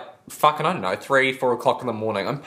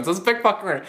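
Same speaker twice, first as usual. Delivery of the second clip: ordinary delivery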